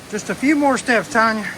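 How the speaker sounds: loud encouraging call; cheering on. Real, not acted. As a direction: shout encouragement up the stairs. Cheering wife near the top.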